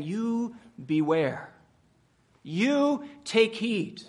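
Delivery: "You beware" slow, with the weight on "You"; stressed YOU, drawn out. Shown as a demonstration. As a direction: lean hard on "You" and stretch the words. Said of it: "And those words are continuous".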